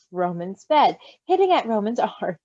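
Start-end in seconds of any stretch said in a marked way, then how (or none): none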